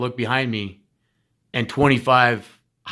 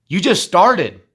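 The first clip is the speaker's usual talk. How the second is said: louder and higher, pushing them on; rising exhortation; 5 semitones higher, 5 dB louder, slower than usual. Real, not acted. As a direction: call it out like a coach. Exhorting students, said twice.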